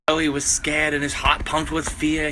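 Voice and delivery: ordinary delivery, nothing marked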